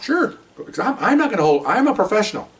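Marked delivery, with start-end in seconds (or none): 0.0-0.3 much higher, lilting; officer answering the lieutenant with pointed breeziness; mock breezy sure. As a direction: act casual and unbothered.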